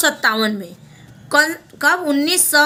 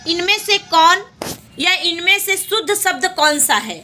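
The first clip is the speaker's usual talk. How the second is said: normal talking voice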